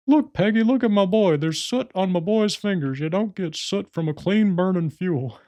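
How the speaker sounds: high, strained, outraged cartoon dad; indignant high-pitched father impression; Hank Hill. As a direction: flip out in a put-on dad voice. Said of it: "Hank will just flip out".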